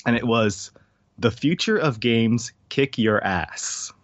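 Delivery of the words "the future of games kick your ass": slow, deliberate slogan with a drawn-out ending; slogan read out. Shown as a demonstration. Quoting an odd T-shirt slogan from a game show, deliberately. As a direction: drag out "kick your ass" word by word. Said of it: "it was the slogan of the show".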